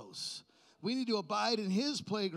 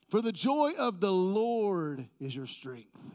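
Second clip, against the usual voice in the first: slow, louder proclaiming; preached verse; 7 dB louder, slower than usual. Acted. Pastor proclaiming scripture, slow and loud.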